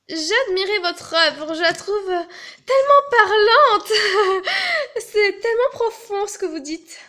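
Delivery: voix aiguë et forte, éperdue; admiration exagérée. Acted. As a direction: en faire des tonnes pour flatter. Flatte l'artiste avec excès. (admiration théâtrale et surjouée)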